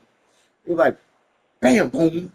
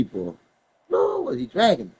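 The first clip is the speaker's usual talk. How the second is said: usual speaking voice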